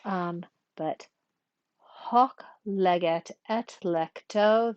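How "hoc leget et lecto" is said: slow, measured Latin scansion; metrical scansion. Shown as a demonstration. Teacher practices scanning a pentameter line for learners, slow metrical reading. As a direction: draw out the long syllables of the verse. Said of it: "we're gonna practice a little bit".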